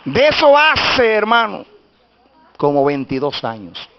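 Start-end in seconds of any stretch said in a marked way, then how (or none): 0.1-1.7 high preaching, building to a shout; prédica triunfal. Real, not acted. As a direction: proclaim the miracle to the congregation. (prédica exaltada, subiendo hasta el grito)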